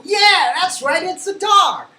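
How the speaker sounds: high-pitched, delighted teacher cheer; big, high classroom encouragement; excited praise. Pretend. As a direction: celebrate a young pupil's right answer. Praising pretend pupil's answer.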